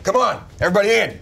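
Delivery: high, fast rallying shout; urgent call. Acted. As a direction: hurry everyone along. Gunthar calls group into wagon.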